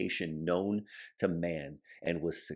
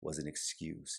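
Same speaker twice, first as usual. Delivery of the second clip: quiet repeat; 2 semitones lower, 9 dB quieter than usual. Shown as a demonstration. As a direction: let "was an excuse" fall to a near murmur. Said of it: "Think about that for just a moment".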